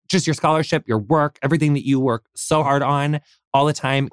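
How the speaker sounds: ordinary talk, nothing marked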